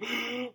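sharp theatrical intake of breath; mock dramatic gasp. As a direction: gasp like a kid about to tattle.

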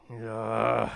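low, drawn-out "ugh"; imitated groan. Preacher acting Jesus hearing the cynicism, low mock groan. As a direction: groan inwardly at what you just heard. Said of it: "again groaning in himself".

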